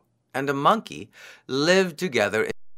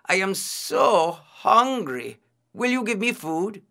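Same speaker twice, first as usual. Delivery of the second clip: crying out in hunger; 8 semitones higher than usual. A narrator's reading. Disguised priest begging the otter for food, pleading. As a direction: draw out the hunger in a high voice. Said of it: "he cried".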